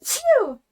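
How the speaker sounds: a real sneeze, sharp and loud; sneezing. Real, not acted. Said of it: "so I'm gonna sneeze again".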